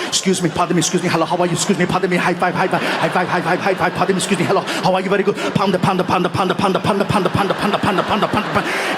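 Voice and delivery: put-on rapid-fire accented star greetings, run together; frantically rapid. Star barely keeping up with fans, frantically rapid. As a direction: rattle them off nonstop in an Austrian action-star accent. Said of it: "He is hitting Arnold so fast".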